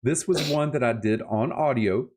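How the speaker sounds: ordinary talk, nothing marked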